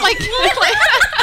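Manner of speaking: squeaky chipmunk voice, laughing; high chipmunk squeak through a laugh. Shown as a demonstration. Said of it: "a chipmunk voice".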